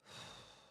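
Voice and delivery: slow breath out to settle yourself; a long soft sigh, letting go; shown calming sigh. Shown as a demonstration. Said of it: "I feel like falling apart right now".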